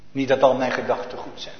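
normal talking voice

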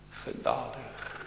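whispered, low final plea; hushed prayer. Retold or imitated. Preacher voicing a dying prayer, whispered. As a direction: let it fade to a whisper. Last words of a sinner's prayer.

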